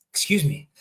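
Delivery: quiet after a hiccup; softly, between hiccups. Real, not acted. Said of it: "these hiccups are vicious".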